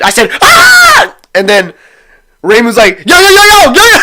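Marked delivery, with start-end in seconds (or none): 0.4-1.2 very high, loud retold scream of fright. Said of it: "I said, I screamed". 3.1-4.0 panicked yelling. Retold or imitated. Friend panicking at scream.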